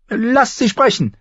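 high, loud order; royal command. A narrator's reading. King Joffrey orders silence for Sansa, commanding. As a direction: command the court to let her speak. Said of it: "befahl er".

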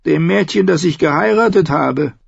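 Tyrion's voice. Acted.

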